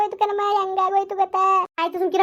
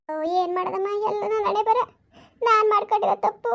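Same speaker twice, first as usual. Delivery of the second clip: higher, dejected lament; 4 semitones higher than usual. Acted. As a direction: lament your fate, voice lifted in misery. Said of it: "ಯಾಕೊಂಥರ ಬೇಜಾರಲ್ಲಿ ಇದೆಯಲ್ಲ?".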